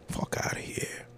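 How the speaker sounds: breathless whispered dismissal. Real, not acted. Repeating the dismissal to himself, whispered. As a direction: drop to a whisper.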